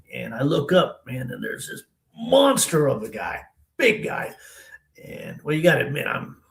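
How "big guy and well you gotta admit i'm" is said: plain reading voice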